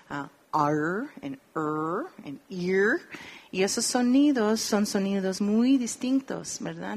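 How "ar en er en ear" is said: "ar", "er", "ear" with a curled English r; English r sounds. Shown as a demonstration. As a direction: demonstrate each English r vowel clearly. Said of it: "Y tenemos". (sonidos "ar", "er", "ear" con r inglesa)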